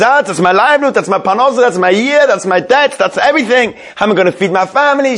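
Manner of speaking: high, mock-desperate outcry; mild scream, piling up each complaint; retold scream. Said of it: "And then she screams".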